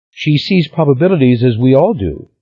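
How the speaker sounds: put-on high, theatrical young woman; a melodramatic sister, sighing. A narrator's reading. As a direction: sigh it out like a drama queen. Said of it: "with a melodramatic sigh".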